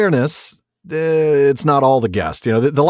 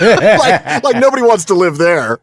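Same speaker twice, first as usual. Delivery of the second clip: laughing, louder and high; 7 semitones higher, 6 dB louder than usual. Real, not acted. Host laughing at listener's Woodland Realm choice, laughing higher. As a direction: laugh it out in disbelief.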